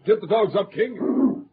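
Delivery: loud, high rallying shouts; commanding the dogs. Acted. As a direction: drive the team on with ringing calls. Preston urging sled dogs on.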